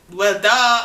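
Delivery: loud mock "well, duh"; sarcastic duh. Telling her boyfriend his question is obvious, loud and sarcastic. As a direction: toss it off as if it's obvious.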